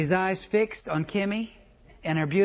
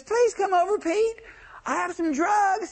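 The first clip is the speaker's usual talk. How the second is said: Kimmy's voice; 11 semitones higher than usual. Retold or imitated. Ex calling Pete over from across the street, high coaxing voice. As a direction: pitch way up and coo at him. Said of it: "Kimmy says".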